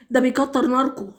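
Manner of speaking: normal talking voice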